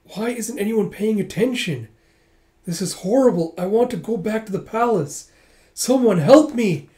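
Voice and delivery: high, halting shock building to a loud cry; acted distress. Punched girl realizing nobody pays attention, distressed. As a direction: start stunned, grow into a panicked plea.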